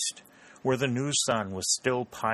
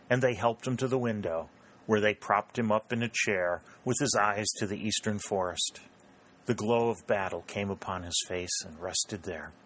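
ordinary delivery

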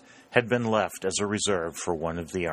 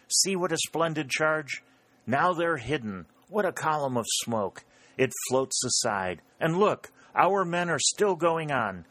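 high, awed excitement; excited commentary; 7 semitones higher than usual. A narrator's reading. Warner describes the splendid charge to his comrades, excited. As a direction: call out each sight eagerly, voice raised in pitch.

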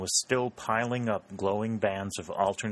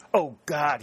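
louder, higher outburst of grief and rage; narrated burst of despair; anguished cry; 6 semitones higher, 6 dB louder than usual. A narrator's reading. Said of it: "with a groan of grief and rage".

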